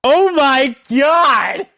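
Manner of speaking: long, high, loud exclamation; shocked shout. Real, not acted. Reacting to what chat wrote, drawn-out shocked shout. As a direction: cry out, stretching every word.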